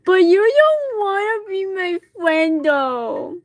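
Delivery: put-on high, babyish, mushed-together drunken whine; tipsy, wounded high-pitched mumbling; slurred drunk. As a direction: slur the words into a pleading whine. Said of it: "comes out as a mix of other words".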